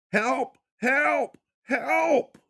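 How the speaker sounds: screaming for help. Acted. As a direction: scream at the top of your lungs. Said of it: "He cried out at the top of his lungs".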